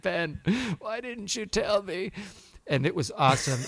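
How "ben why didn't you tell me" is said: higher, slow, wounded question; lightly voiced hurt Luke. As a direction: ask it like a betrayed young Jedi. Son voicing Luke to Ben.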